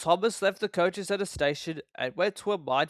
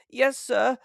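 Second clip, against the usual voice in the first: nervous reply; 8 semitones higher, 3 dB louder than usual. A narrator's reading. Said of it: "said Thomas nervously".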